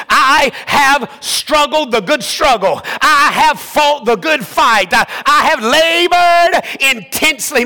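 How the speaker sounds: fervent sermon delivery; full-voiced pulpit preaching. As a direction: preach it to the congregation.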